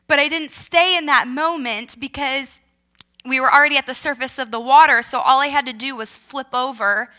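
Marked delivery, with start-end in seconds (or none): none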